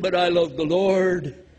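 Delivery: slow, lightly voiced pious believer; pious excuse. Mocking the believer who excuses himself, lightly voiced. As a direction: play the smug believer, slowly. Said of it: "They said".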